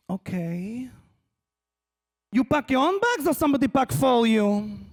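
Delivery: Israeli interrogator. Retold or imitated. El Al screener questioning family about bags, probing. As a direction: grill them in an Israeli accent. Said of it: "with that interrogation".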